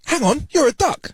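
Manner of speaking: high-pitched disbelief; astonished barman. Acted. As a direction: react in surprise, pitch jumping up.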